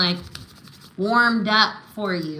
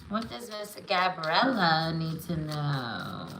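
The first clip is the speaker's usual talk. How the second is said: soft, slow, lower murmured asking; musing card-reader question; 4 semitones lower, 6 dB quieter than usual. Real, not acted. Reader asking spirit for a client's message, musing and slow. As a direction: draw the question out quietly as you shuffle.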